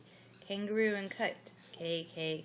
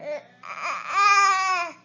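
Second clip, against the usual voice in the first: loud high burst of laughter; real laughter; 11 semitones higher, 12 dB louder than usual. Real, not acted. Reading a book, bursting into laughter. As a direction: crack up laughing.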